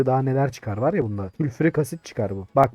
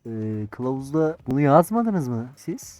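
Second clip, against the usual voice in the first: usual speaking voice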